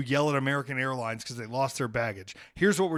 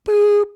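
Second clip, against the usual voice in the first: very high, loud cartoon boop noise; mock button-press sound; squeaky boop; 14 dB louder than usual. Shown as a demonstration. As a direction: pop out a silly high boop. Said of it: "You know what I'm doing?".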